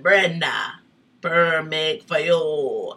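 slow, drawn-out imitation of a hairdresser; emphatic hairdresser quote. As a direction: stretch each word out loudly, imitating insistent advice. Quoting her hairdresser's emphatic advice.

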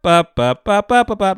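loud high popping noises; firecracker pops. Retold or imitated. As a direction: fire off pops like crackers. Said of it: "mimicking popping sounds".

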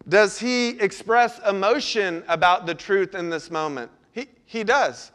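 ordinary talk, nothing marked

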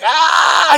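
high loud yelp of pain; mock pain scream. Drunk coworker yelping in pain, loud mock scream. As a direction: cry out like a hurt leg got squeezed.

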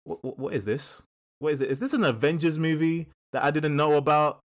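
lightly acted baffled TV viewer; mock disbelief. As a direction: play a viewer dismissing nonsense on screen.

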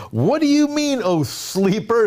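high, incredulous sea captain; mocking captain. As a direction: scoff at the sleeper from up high. Captain rebukes sleeping Jonah.

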